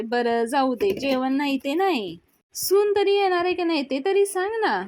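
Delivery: building to a high, loud demand; needling demand. Acted. Sulakshana demands news of the bride, needling. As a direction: press them with pointed exasperation.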